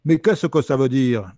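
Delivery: sneering Toulouse accent imitation; mock Toulouse accent. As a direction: stretch it out in a caricatured Toulouse accent. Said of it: "Donc ça donne ça".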